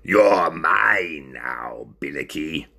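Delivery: low, very slow gloating; smiling villain. Acted. Ringmaster gloating at caged Binnicky, low and slow. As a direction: savour it slowly with an evil smile. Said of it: "said with a smile".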